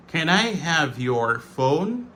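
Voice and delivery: "your" as a full yor, slowly; full careful form. Shown as a demonstration. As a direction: spell out each word slowly, "your" unreduced. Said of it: "Réduction de your".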